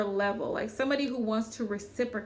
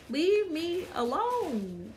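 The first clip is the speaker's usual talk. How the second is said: fed up; 4 semitones higher, much slower than usual. Real, not acted. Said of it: "fed up reply".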